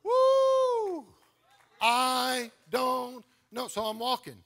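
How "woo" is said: excited whoop. Real, not acted. Preacher exclaiming mid-sermon.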